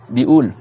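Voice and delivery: bi'oul with q dropped to a glottal stop; Lebanese bi'oul without qaf. Shown as a demonstration. Teacher gives his Lebanese form, demonstrating the dropped qaf. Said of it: "on dit bioul".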